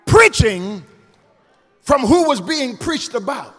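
forceful preacher cadence, key words drawn out; preaching. Real, not acted. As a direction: punch the key words high and loud, then let each phrase fall. Preaches to congregation.

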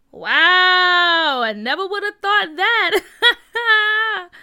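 high, loud mocking amazement; amused, hammed-up disbelief; laughing. A narrator's reading. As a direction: stretch out the wow, end in laughter. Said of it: "Carr said, laughing".

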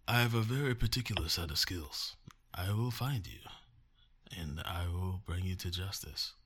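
lightly voiced movie tough guy; a hint of Liam Neeson's threat; Liam Neeson impression. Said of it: "what's that quote from the movie".

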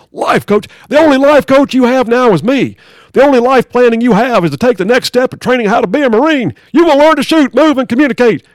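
drill sergeant. Acted. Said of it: "drill sergeant".

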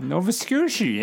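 high, hammed-up Canadian accent. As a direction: sing it out like a Maritimer, eh.

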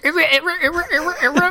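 high, loud mock turntable scratch noises; record scratch. Ouija board turned turntable, mock scratch sounds. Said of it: "the Ouija's all like".

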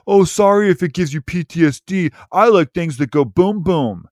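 mock obnoxious bro; mocking a jerk. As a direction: sneer it like a guy who loves things that go boom. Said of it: "some asshole out there who's like".

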